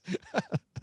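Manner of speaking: a squeaky, very high laugh. Real, not acted. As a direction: still cracking up. Laughter continues.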